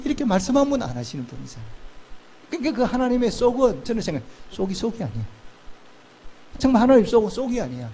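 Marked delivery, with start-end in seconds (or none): none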